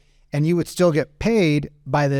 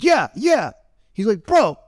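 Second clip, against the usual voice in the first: high, loud back and forth; two men shouting over each other; super loud argument; 7 semitones higher than usual. Retold or imitated. Customer and owner arguing, mock loud. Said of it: "super loud".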